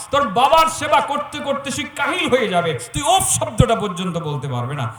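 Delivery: loud, high, impassioned preaching at full force; fiery preaching. Acted. Preacher warning the congregation not to say uff to parents, loud fiery preaching. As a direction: thunder the warning at the crowd.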